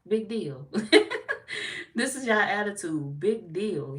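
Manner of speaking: big deal, laughing loudly; loud amused laugh through the words. Real, not acted.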